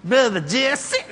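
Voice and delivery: high, stretched-out greeting of his name; long, lilting high drawl; drawling friendly lady. Retold or imitated. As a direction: mimic a lady calling out slowly. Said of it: "That's how she talked".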